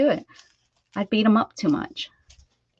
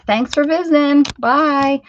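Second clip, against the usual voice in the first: bright, louder sing-song farewell; cheery goodbye; 5 semitones higher, 8 dB louder than usual. Real, not acted. Owner thanking the leaving dog, a cheery loud goodbye. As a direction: wave the visitor off cheerfully.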